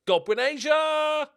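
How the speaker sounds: high, loud, stretched-out yell; excited name shout. Real, not acted. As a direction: roar the player's name as he shoots. Shouts player's name at a chance.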